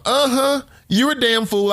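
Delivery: high-pitched dismissive comeback; derisive. Acted. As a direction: brush off the fool with open scorn. Dismissing the mimicked voter.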